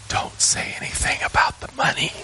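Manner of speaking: whispered secret warning. Retold or imitated. Older brother warning little brother, whispering. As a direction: breathe it so Dad can't hear.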